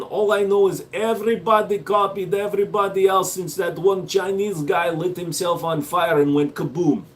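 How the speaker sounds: put-on Russian accent, pitched a little up. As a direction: play a hammed-up Russian. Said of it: "Americans doing Russian accents always sound like this".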